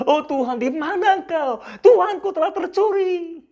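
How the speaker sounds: high, wailing lament with a broken laugh; a lightly voiced grieving Maria; Maria's lament. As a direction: cry out to God as if the grave were robbed. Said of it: "Seperti Maria meratapi nasibnya".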